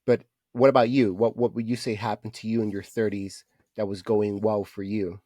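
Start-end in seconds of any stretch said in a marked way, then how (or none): none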